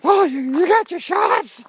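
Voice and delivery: sneering imitation of the question; mocking nosy questioner. Imagined people ask about shots.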